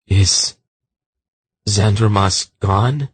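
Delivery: halting, nervous question; shaking voice. A narrator's reading. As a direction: ask haltingly, a touch afraid. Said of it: "asked in a shaking voice".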